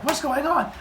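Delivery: high, confused waking voice; acted bewilderment without shouting; startled woman. Startled woman just woken.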